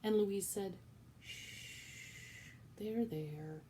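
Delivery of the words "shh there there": soft shush, gentle comfort; calm her like a child; soothing hush. Acted. Louise comforting wailing Cook, soft.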